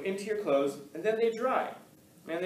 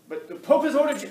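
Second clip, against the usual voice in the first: much higher and louder, pushing the order; insistent messenger; 11 semitones higher, 11 dB louder than usual. Acted. A messenger insisting that Michelangelo obey the Pope, high and loud. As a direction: press the Pope's command on him.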